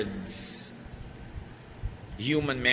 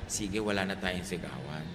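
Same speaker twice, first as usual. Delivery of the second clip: quiet, lower-pitched promise to a spouse; soft agreement; 7 semitones lower, 8 dB quieter than usual. Pretend. Spouse to spouse agreeing to stop shouting, softly. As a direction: say it low and soft, as a peace offering. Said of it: "sabi nyo".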